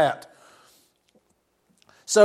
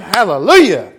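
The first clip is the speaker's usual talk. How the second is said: a loud, ringing cry of praise; joyful exclamation; 8 dB louder than usual. Real, not acted. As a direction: shout Hallelujah out to the congregation.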